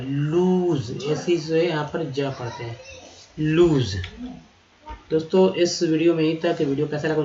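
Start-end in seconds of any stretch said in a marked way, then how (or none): 0.0-1.0 slow drawn-out word; slow demo. Shown as a demonstration. Teacher to students, slowly drawn word. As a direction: stretch the vowel. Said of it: "teacher stretches word".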